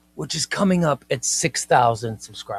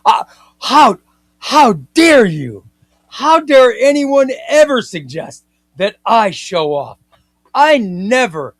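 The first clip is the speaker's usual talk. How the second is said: mock indignation; 6 semitones higher, 7 dB louder than usual. Mock outrage at viewer's joke.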